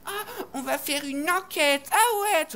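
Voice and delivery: high, slow, gushing mock kid voice; mocking eager kids. Kids mocked as eager little investigators. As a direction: ham up the starry-eyed kids. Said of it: "Ils disaient".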